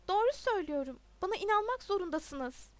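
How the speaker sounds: loud, high plea; urgent pleading. A narrator's reading. Pupil begs his teacher to believe him, urgent pleading. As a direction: insist urgently on being believed.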